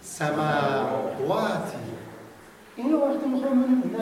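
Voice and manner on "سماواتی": سماواتی stretched very slowly, syllable by syllable; slow corrected reading. Shown as a demonstration. Teacher corrects a student's reading, very slow. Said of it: "غلط خوندی شما. دوباره".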